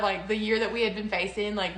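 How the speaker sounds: ordinary talk, nothing marked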